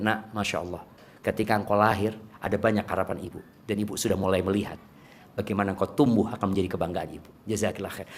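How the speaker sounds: put-on soft, low words to a child; tender parent voice, kept low; hopeful, proud mother. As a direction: gently tell your child of your hopes. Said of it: "bagaimana dia berharap kepada anaknya".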